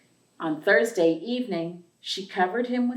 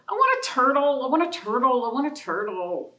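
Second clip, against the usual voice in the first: put-on higher, louder whiny child chanting wants; demanding little girl; 7 semitones higher, 4 dB louder than usual. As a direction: nag like a little girl who wants a pet. Child character repeating demands.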